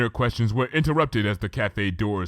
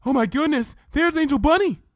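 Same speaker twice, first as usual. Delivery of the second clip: much higher and louder, delighted squeak; excited Fluttershy; 5 dB louder than usual. Acted. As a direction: spot the bunny and light up. Fluttershy spots her bunny.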